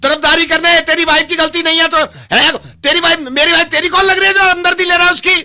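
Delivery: high, loud furious rant; angry shouting. Acted. Husband tearing into the counsellor, furious shout. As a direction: tear into him.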